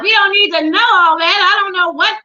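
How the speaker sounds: embarrassed outburst, pitched well up; flustered protest. Real, not acted. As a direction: protest loudly at too much information. Flustered protest at racy message.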